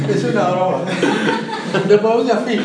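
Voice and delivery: high, loud, breaking up at the punchline; real laughter. Real, not acted. Teacher telling students a spelling joke, laughing out loud.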